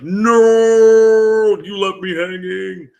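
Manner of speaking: mock wail, loud drawn-out high "No"; hammed-up outcry, held long then easing off; wailing viewers' protest. Streamer imitating viewers upset at an unfinished session, mock wailing. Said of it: "people are gonna be like".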